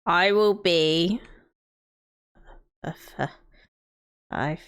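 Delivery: ordinary talk, nothing marked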